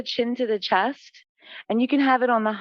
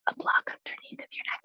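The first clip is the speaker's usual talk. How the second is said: hushed, private whisper; whispered aside; 10 dB quieter than usual. Real, not acted. Yoga teacher quietly helping one student mid-class, whispered aside. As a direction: whisper it discreetly to one person.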